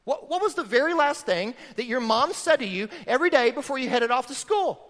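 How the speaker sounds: ordinary delivery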